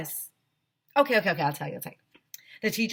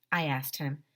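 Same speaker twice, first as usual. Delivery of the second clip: plain reading voice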